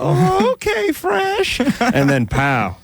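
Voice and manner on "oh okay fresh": very high, slow silly voice; high mock voice. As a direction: coo it in a squeaky falsetto. Acting out the joke.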